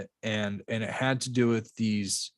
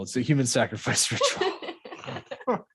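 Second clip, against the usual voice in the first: laughing; 3 semitones higher, slower than usual. Real, not acted. Laughs mid-sentence at the topic.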